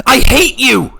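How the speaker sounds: very high, very loud scream of hatred. A narrator's reading. Revna screams her hatred at the monk. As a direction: scream it with everything. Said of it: "she screamed".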